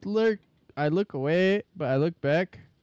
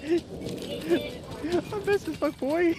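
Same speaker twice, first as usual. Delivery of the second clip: squeaky pitched-up voice; raised, stretched-out voice; high-pitched voice; 9 semitones higher, much slower than usual. Real, not acted. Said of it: "Oh, I'm super fast now".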